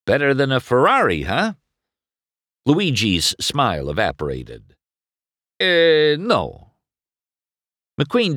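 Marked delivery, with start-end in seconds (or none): 0.0-1.5 fast, loud cocky tease; cocky race car. Acted. McQueen teasing Luigi about Ferraris, fast cocky brag. 5.6-6.7 loud, high Italian "no" with the smile gone; curt Italian refusal. Acted. Luigi offended by the Ferrari joke, curt refusal.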